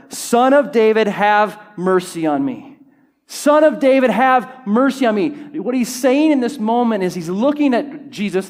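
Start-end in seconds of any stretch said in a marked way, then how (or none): 0.1-5.3 loud, high cry for mercy; shouted plea. A narrator's reading. Blind man calling to Jesus as he passes, shouting louder. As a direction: call out over the crowd, drawing out the plea. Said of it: "but he only shouted louder".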